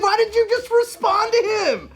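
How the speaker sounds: shocked. Retold or imitated. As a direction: squeak it out in disbelief. Shocked at dad's reply.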